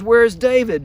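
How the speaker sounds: loud, sharp demand from the king; King Saul demanding where David is, loud and angry. Acted. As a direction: bark it at your son across the table.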